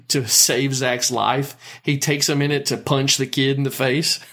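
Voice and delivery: ordinary delivery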